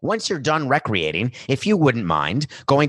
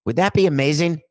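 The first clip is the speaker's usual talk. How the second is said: normal talking voice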